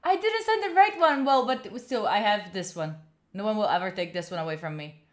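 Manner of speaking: ordinary delivery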